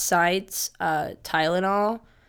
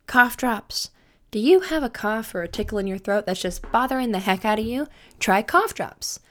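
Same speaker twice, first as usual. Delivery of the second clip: hammed-up commercial voice; ad read; 3 semitones higher, 4 dB louder than usual. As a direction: play it like a cheesy radio ad. Mock sponsor ad read.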